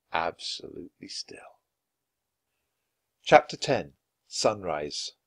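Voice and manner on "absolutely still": near-whispered narration; hushed. A narrator's reading. Narrator ending the chapter as all stops, hushed. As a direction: let it fall to a hush.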